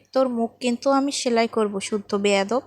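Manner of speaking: ordinary delivery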